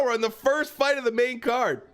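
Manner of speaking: normal talking voice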